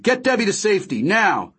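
quick, high command; urgent order. A narrator's reading. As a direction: rap out the order fast. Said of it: "my father ordered".

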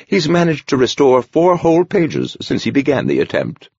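put-on a gentle old monk speaking kindly; gentle old man voice. A narrator's reading. Horner describes Sarl's method.

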